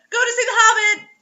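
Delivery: high loud laughing exclaim; laughing mock outrage. Real, not acted. Reader laughing in mock outrage at the co-host's plans.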